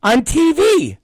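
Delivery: high-pitched mock child, matter-of-fact; kid's voice. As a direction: answer brightly like a little kid. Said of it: "He goes".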